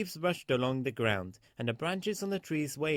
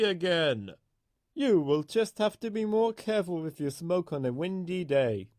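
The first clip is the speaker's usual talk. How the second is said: higher, louder stern official voice; stern fat controller; 5 semitones higher, 5 dB louder than usual. A narrator's reading. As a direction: scold the engine firmly, like a strict boss. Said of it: "he said sternly".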